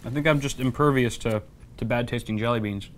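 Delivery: chewing while talking; mouth full. Real, not acted. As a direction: talk through a mouthful of jelly beans. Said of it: "You just chowing down over there?".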